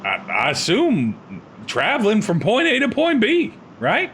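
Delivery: high-pitched rough lizard drifter; lizard man voice. Acted.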